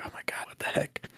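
hushed, voiceless exclamation of disbelief; whispered "what the heck" reaction; whispered shock. Acted. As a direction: whisper it under the breath, aghast. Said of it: "and he's like".

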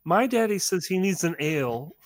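put-on high, sweet little-girl voice; a little girl's voice. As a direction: play the innocent child asking for a drink.

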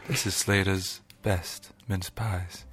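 low, slow, sultry ad read. Acted. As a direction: purr it like a luxury food ad. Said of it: "You did the Marks and Spencer's sultry voice".

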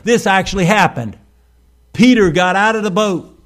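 high, loud preacher's insistence; forceful preaching. Real, not acted. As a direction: proclaim it emphatically to the congregation.

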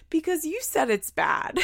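put-on high, raspy child voice; imitated little girl. Retelling the child's comeback to mom, high little-girl voice. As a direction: put on a small raspy kid's voice. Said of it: "Her little raspy voice".

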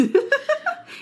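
high-pitched burst of laughter; giddy high laugh; high squeal laugh. Real, not acted. High laugh after joke.

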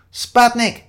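loud, high interjection; blurted answer. Acted. Shiloh calls out answer from floor.

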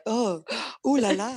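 high, loud French ooh la la; gasp, then go hammed-up French; French accent. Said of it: "his French accent".

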